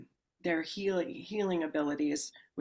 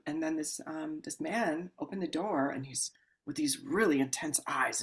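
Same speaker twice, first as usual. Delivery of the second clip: normal talking voice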